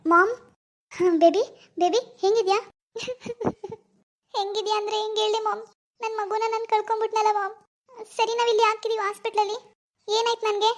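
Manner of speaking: put-on a grieving young woman close to sobbing; tearful daughter. Daughter grieving her lost baby to her mom, tearful.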